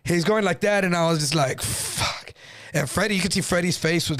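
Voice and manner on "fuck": breathed curse under the breath; hushed, voiceless reaction; dismayed whisper. Retold or imitated.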